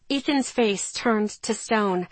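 ordinary delivery, nothing marked

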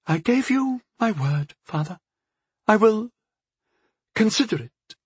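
high-pitched, halting concession; great reluctance. A narrator's reading. Daughter yields to her father, reluctant and halting. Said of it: "with great reluctance".

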